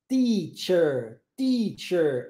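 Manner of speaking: carefully modelled "Teacher", T released with a slight hiss; teacher modelling the T of "Teacher"; English T in "teacher". Shown as a demonstration. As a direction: say "Teacher" slowly with a crisp English ts-like T. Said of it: "o som do T".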